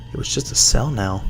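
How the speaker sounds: ordinary delivery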